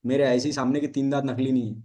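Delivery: ordinary talk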